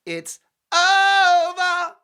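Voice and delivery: over held very high and very loud, slowly; bigger drawn-out it's over. Acted. Host topping his own line, held very high and loud. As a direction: soar the over up as far as it goes, drawn out.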